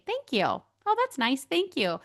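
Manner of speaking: high lifted thank-you, sweetly accepting; gracious thanks. Shown as a demonstration. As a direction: accept the compliment with a high happy lilt. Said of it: "I could just say".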